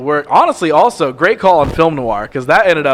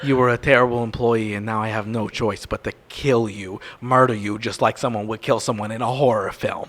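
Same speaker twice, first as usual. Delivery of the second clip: dark monster voice; 5 semitones lower, 3 dB quieter than usual. Acted. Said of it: "It's darker when I do this one".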